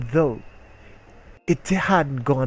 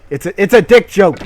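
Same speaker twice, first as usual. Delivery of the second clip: much louder, higher, pushing the joke; loud insistent pun; 6 semitones higher, 12 dB louder than usual. Real, not acted. Gamer explaining his own pun to chat, loud and insistent. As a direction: shout the punchline at the viewers.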